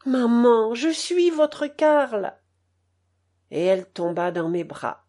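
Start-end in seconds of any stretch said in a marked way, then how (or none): 0.1-2.3 repeated emotional reveal. Retold or imitated. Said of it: "répéta-t-il".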